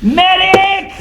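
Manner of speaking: high, loud comic yell; shouting for a medic. Acted. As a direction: call out like an emergency. Calling out for help, joking.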